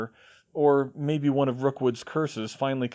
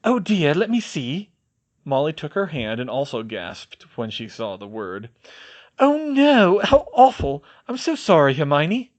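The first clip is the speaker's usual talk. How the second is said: motherly Molly; 4 semitones higher, 6 dB louder than usual. A narrator's reading. Molly sympathetic, high.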